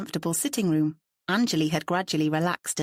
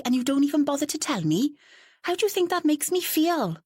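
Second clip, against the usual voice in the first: angry; 10 semitones higher than usual. Acted. Angry phone quarrel.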